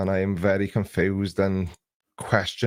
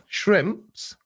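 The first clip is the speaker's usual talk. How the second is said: incredulous; 10 semitones higher than usual. Real, not acted. Incredulous at chat about shrimp.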